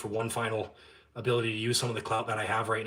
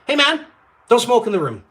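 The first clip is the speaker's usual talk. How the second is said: raised retold request; 11 semitones higher, 10 dB louder than usual.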